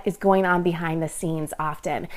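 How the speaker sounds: whisper. Real, not acted. Reflective point after sad story.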